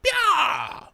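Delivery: very high mock voice agreeing blindly; mocking dumb follower. Mocking the public, high mindless yeah. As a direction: play the clueless sheep nodding along. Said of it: "And we're like".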